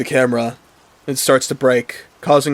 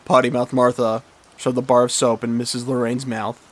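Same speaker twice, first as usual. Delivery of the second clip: usual speaking voice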